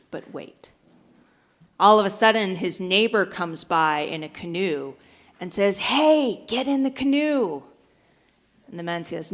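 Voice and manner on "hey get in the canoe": higher, raised call to someone stranded. Acted. Neighbor in a canoe to man on roof, calling out. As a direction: hail them urgently from a little way off.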